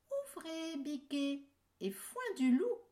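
put-on high sing-song call to the kids; mother goat calling. Mother goat's password call.

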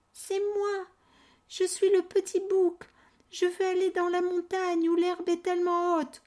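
high little goat voice; small goat voice. Acted. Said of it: "Poilu, le plus petit".

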